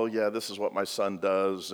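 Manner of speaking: quiet, falsely casual parent; mock composed. Parents at the temple acting holy and composed. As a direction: pretend all is fine, hushed. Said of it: "they go".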